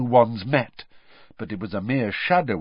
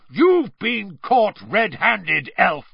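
slow, higher, louder accusation; roaring; 10 semitones higher, 6 dB louder, slower than usual. Acted. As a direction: roar the charge at her. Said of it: "Mister Diggory roared".

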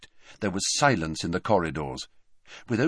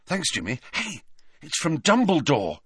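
higher-pitched, eager discovery; eager news from a friend; excited; 6 semitones higher than usual. A narrator's reading. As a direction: perk up with sudden excitement. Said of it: "said Harry excitedly".

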